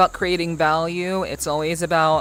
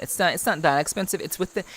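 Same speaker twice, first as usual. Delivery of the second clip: usual speaking voice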